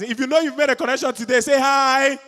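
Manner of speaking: energetic hype-up, fast, then shouted; hype call. Real, not acted. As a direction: pump up the crowd. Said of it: "Let's go again".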